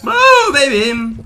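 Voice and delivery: long drawn-out, high-pitched whoop; exultant cheer. Real, not acted. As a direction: stretch it out high in celebration. Celebrating a play in the game. (celebración eufórica, alargada y aguda)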